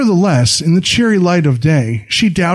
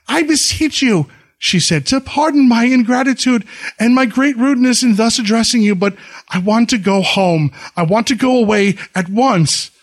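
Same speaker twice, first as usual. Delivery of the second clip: higher, imploring bride; pleading young wife; 8 semitones higher than usual. A narrator's reading. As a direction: beg him to let you go home. Bride begs her husband.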